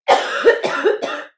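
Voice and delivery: loud harsh coughing fit; real cough. Real, not acted. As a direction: cough hard off mic. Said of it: "I'm coughing a lot".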